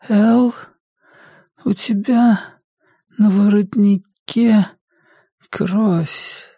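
high, weak voice with long halting pauses; frail, labored mother. Acted. Sick mother notices blood on her son, frail and labored. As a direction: push out each word as an ailing mother.

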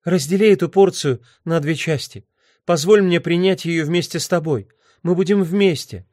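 high, urgent woman's voice; pleading woman. Acted. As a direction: beg him to share it with you.

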